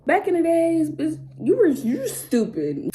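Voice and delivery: usual speaking voice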